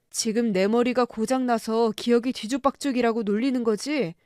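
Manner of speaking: usual speaking voice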